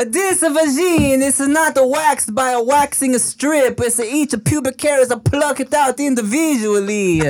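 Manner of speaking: put-on high, singsong Italian accent. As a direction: pitch it like a mock Italian salesman.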